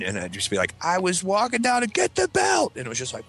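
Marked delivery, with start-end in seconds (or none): 0.8-2.7 put-on slow, high-pitched little-kid voice; doomed kid answering. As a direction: answer dad as a hapless child, voice way up. Kid answering his angry dad.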